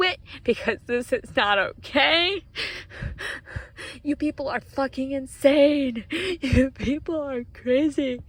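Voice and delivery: fighting back sobs, the words breaking; on the verge of tears. Real, not acted. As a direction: voice cracking toward real tears. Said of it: "I'm about to fucking cry".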